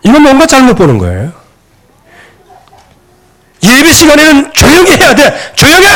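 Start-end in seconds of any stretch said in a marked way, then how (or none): 3.6-5.9 high, sharp mock order for silence; scolding command. Parent to child during worship, acted scolding.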